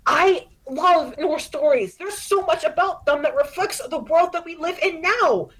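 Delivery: put-on a kid defending what she loves; lightly voiced earnest teen; defensive daughter. Daughter defends her passion.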